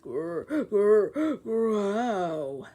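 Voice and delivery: throaty growl; rumbling stomach growl; growling noise. Acted. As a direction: growl like a beast.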